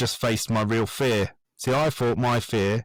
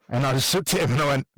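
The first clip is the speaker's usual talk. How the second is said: ordinary talk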